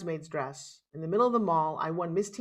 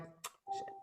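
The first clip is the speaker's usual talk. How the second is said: whispered curse; 18 dB quieter than usual. Retold or imitated. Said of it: "she's like".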